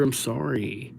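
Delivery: usual speaking voice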